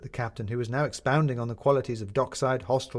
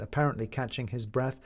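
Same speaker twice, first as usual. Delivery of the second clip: usual speaking voice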